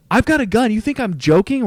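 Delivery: high, loud raging shout; retold enraged driver yelling; furious shout. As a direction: yell it to scare them off. Driver keeps threatening.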